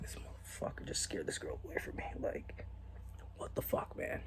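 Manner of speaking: hushed, whispery grumble; very soft, private cursing; exasperated inner thought. Retold or imitated. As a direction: mutter it under your breath in disbelief. Silent thought about his friend.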